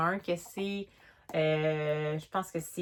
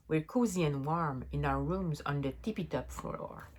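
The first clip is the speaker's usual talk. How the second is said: reading the English rhyme aloud in a French-accented voice, slow at the start; a storybook read-aloud in English with a French accent. Shown as a demonstration. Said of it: "excusez mon, mon accent". (lecture à voix haute en anglais avec l'accent français)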